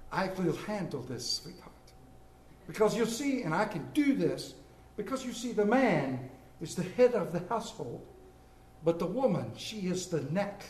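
softly spoken, lightly imitated mother; knowing movie mama. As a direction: quietly confide it like a wise mother. Mama soothes daughter in movie scene.

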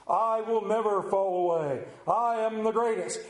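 hammed-up superhero proclamation; puffed-up, heroic self-praise; boastful Peter. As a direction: boast grandly as a mighty disciple. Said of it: "disciple man or something".

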